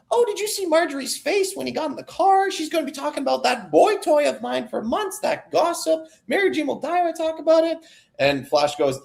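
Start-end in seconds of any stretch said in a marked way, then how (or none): 0.1-7.8 very high put-on old-lady voice, fast at the end; gossipy old aunt. Aunt Anna boasting to Flash in the car, high gossiping old-lady voice. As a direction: chatter like a delighted gossiping aunt. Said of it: "Aunt Anna's like".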